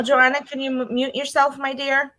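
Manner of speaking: ordinary talk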